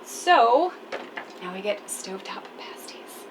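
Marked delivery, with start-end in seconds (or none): 1.4-3.2 hushed aside, voiceless; whisper. Real, not acted. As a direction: whisper it like a confession. Whispered aside about burnt lid.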